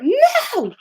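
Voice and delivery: very high, loud mock tantrum; drawn-out toddler shriek, imitated; imitated screaming toddler. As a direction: squeal it like a defiant small child. Screaming toddler refuses to be picked up.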